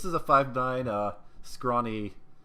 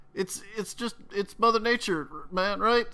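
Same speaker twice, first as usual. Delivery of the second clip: high, rushed, nervous explanation; spilling it out to save himself; frightened confession; 13 semitones higher, 5 dB louder than usual. Acted. Fred babbling his secret to the interrogator, high nervous voice.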